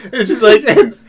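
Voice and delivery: laughing so hard it nearly turns to tears; helpless laughter. Real, not acted. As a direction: crack up mid-sentence. Laughing at a funny video.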